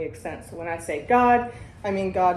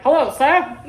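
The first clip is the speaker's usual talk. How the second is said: put-on higher, louder, prim old-fashioned "Hello, sir"; old-timey phone greeting; 7 semitones higher, 10 dB louder than usual. Host imitating Bill W. calling from a phone booth, prim and old-fashioned. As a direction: mimic a stiff 1930s caller on the phone. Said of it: "That's how they talked".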